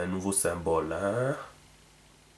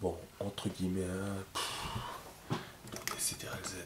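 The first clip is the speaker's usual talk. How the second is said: muttered aside; 3 semitones lower, 18 dB quieter than usual. Real, not acted. Muttered aside while drawing.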